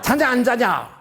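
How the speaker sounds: high, loud comic scolding; mock nagging. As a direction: imitate an annoyed bedtime complaint. (과장되게 높여 쏘아붙이는 핀잔)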